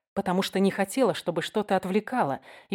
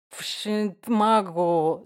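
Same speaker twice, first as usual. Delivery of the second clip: put-on drunk slur, very slow; slurred cynical barfly; much slower than usual. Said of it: "заплетающимся языком сказал я".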